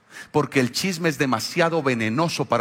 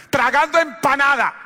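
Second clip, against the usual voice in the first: mock wife scolding; 10 semitones higher, 4 dB louder than usual. Imitating wife's scolding.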